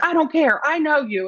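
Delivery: lightly voiced bossy friend ordering her back up; best friend nagging her up the mountain, hammed-up demand; Tiger Mom. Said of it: "we call her Tiger Mom".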